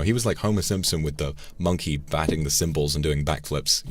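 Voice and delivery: ordinary delivery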